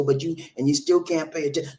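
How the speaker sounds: ordinary talk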